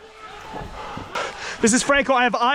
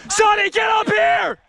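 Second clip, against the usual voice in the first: shouting for someone; 8 semitones higher, slower than usual. Real, not acted. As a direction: call him over, urgently. Calling a teammate under fire.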